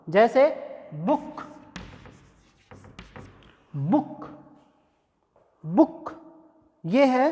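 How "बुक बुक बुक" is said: बुक with a short u; model pronunciation. Shown as a demonstration. Teacher repeating बुक for students, slow demo. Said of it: "ये है ऊ sound".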